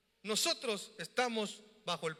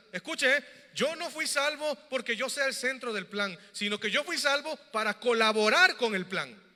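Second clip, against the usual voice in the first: louder, ringing preacher's call; preaching; 3 semitones higher, 5 dB louder than usual. Acted. Pastor pressing the gospel on his church, loud preaching. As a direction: drive it home to the congregation.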